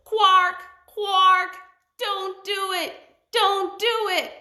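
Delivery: high loud squawking warning; warning bird call. A narrator's reading. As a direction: screech the warning down from above. Fish eagle warns again.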